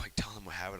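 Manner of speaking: ordinary talk, nothing marked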